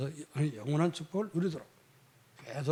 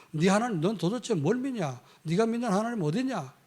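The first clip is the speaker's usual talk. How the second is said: imitating a jeering taunt in a raised pitch; mocking; 6 semitones higher than usual. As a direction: taunt them as a scornful neighbour would. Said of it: "조롱하고". (조롱하듯 따지는 말투)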